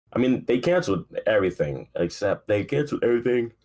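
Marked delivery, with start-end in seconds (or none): 2.5-3.5 said through a yawn. Real, not acted. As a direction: yawn pulling the pitch up. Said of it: "I keep yawning".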